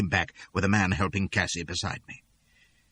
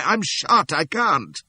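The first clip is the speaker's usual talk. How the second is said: pained raised protest; cries out; 8 semitones higher, 3 dB louder, much slower than usual. A narrator's reading. Wounded man refusing to go on, raised pained cry. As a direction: cry it out, hurt and spent. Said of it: "cries I".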